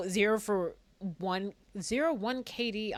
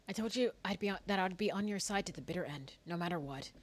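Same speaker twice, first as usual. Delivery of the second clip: solemn game character; 7 dB quieter than usual. Acted. Reads game dialogue in character.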